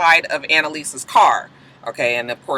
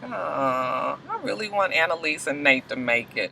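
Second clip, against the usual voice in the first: soft, hushed sigh of longing; tender aw; 2 semitones lower, 11 dB quieter than usual. Real, not acted. Fan hoping the couple lasts, soft and tender. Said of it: "I was just like".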